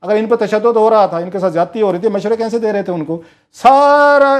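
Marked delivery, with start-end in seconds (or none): 3.6-4.3 sudden loud, high punch on सारा; loud stress on सारा; emphatic outburst. Real, not acted. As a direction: slam the word सारा down hard.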